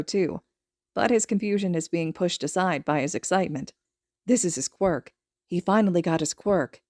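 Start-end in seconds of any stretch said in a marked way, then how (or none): none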